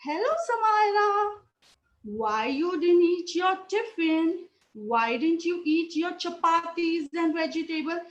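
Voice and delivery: high, lilting butterfly voice; butterfly character. Acted. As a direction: call out sweetly as a butterfly, pitch lifted. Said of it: "butterfly, saw Samaira and said".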